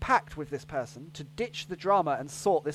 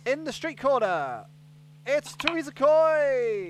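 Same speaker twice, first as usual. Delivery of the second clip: boxing announcer; 7 semitones higher than usual. Pretend. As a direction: announce her like a prizefighter.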